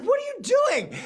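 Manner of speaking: shocked COO yelling; incredulous shout. Retold or imitated. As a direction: yell it like you can't believe it. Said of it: "And he's like".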